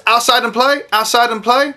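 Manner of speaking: put-on high, sing-song little girl; excited child voice. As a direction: chant it like an excited kid running out. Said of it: "Susan ran to the door and goes".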